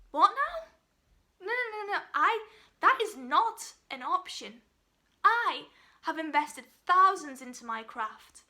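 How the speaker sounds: high, loud burst of indignant refusal; outraged disbelief. Acted. Actor told to retrain, refuses.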